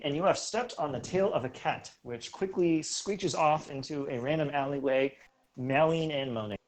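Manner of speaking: ordinary talk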